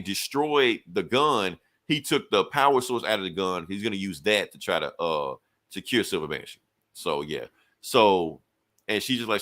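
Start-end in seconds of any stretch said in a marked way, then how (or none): none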